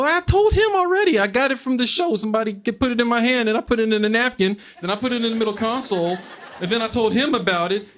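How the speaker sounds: high, breathless rush of excuses; frantic high pleading. Retold or imitated. Comic frantically explaining the weed to cops, high and desperate. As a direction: talk fast and high like you're panicking.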